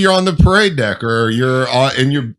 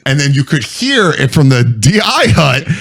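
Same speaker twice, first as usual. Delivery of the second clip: ordinary delivery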